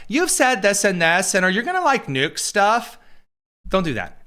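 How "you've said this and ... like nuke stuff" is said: hammed-up leading question, much higher; mock interviewer. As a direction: parody a loaded interview question. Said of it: "you saw this with P B S".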